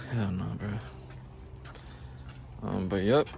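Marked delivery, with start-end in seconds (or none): 0.1-0.7 whisper. Real, not acted. Whispered aside about boxes.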